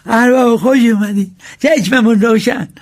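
bow and welcome the master, fawning; humble servant greeting. Acted.

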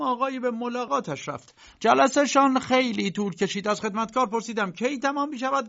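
high, earnest letter voice; reading a daughter's letter. Acted. Uncle reading his daughter Erna's worried letter, high and earnest. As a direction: read her worried words aloud.

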